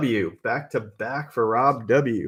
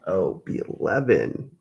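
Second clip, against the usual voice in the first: ordinary talk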